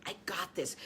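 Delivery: soft unvoiced whisper. Shown as a demonstration. Coach modelling the inner whisper for listeners. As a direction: breathe the words out in a whisper.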